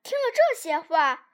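plain reading voice

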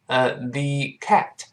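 usual speaking voice

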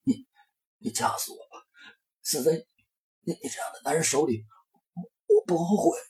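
strained, hushed, halting gasps; choked broken words. Acted. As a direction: force it out while being choked. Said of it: "徐某这样断断续续地说着".

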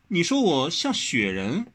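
can't believe what she heard; indignant question. Acted. Wen Rou offended at being called a snowman.

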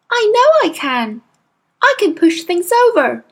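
put-on a girl recounting something shocking; young girl voice. A narrator's reading. Matilda voiced.

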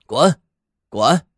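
loud hoarse barking shout; a chained old watchdog barking; barking old dog. Acted. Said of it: "那条链子拴着的老看家狗在叫".

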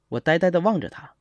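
plain reading voice

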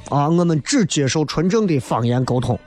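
ordinary talk

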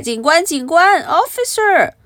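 high, urgent storybook call; acted high-pitched cry for help. A narrator's reading. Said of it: "Baby叫了起来".

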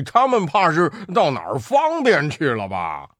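憨笑的八戒，带着玩笑; 笑呵呵地打趣; 八戒笑着说. Acted. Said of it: "八戒笑道". (八戒憨笑)

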